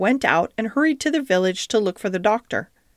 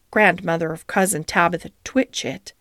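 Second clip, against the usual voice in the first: ordinary delivery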